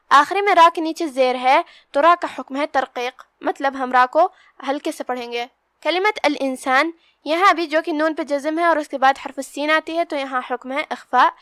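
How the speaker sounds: ordinary talk, nothing marked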